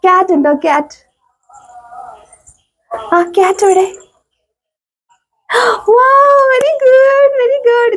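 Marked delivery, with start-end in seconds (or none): 5.9-7.9 delighted praise. Real, not acted. Mother praising her toddler for fetching, delighted. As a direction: stretch the praise out high and slow.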